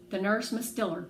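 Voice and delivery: plain reading voice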